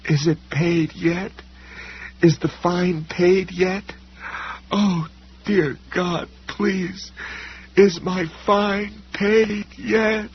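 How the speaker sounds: begging in panic, voice climbing high; terrified scream. A narrator's reading. Sam flees the wolf, high slow terrified pleading.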